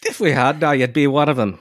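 high-pitched stage-Irish lilt; caricature Irish accent. Acted. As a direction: play up an old-fashioned Irish-American brogue. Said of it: "nineteenth century caricatures of Irish Americans".

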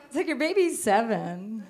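ordinary delivery

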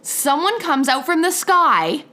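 ordinary delivery

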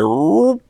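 high, rising "whoop"; whoop noise. Acted. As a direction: imitate a cartoon swoop upward. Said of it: "he's like".

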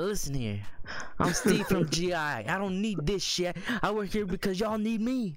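cocky high-pitched bro voice; frat bro. Retold or imitated. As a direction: brag like a swaggering bro who quits. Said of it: "I'm like".

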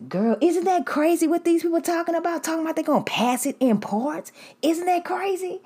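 high mimicked classmate. Classmate pressing the narrator to agree, in a high mimicking voice. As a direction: voice her high and insistent.

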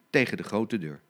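plain reading voice

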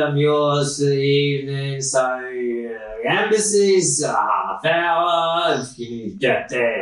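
slurred, dragged-out rambling, words trailing loosely; drunk-sounding coach driver. Acted. Coach driver telling passengers the travel time, drunkenly slurred. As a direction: ramble slowly as if tipsy. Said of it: "are, are you drunk?".

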